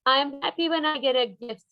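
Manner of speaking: mock-excited reading of a happy page; happy storybook voice. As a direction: sound delighted with the gift. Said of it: "And this is about feelings".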